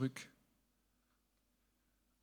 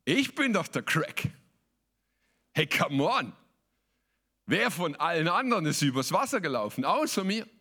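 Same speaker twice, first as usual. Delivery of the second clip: boastful Petrus; 4 semitones higher than usual. Retold or imitated. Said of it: "hat er sich mal gefragt".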